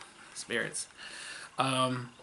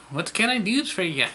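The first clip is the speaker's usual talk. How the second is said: higher, louder mock Irish accent; 7 semitones higher, 6 dB louder than usual. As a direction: have a go at a stage Irish accent. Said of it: "like, a stereotypical Irish accent".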